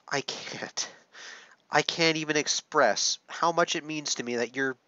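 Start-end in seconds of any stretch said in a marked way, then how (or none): 0.1-0.9 barely voiced, tearful whisper; can hardly get the words out; choked whisper. Real, not acted. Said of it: "getting this emotional on camera".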